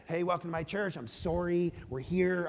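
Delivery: a soft, apologetic, overly polite Canadian greeting; overly nice Canadian. Retold or imitated. Pastor mocks a nice Canadian church welcome, softly apologetic. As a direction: apologize your way through the welcome. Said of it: "supposed to be really nice because Canadians are nice".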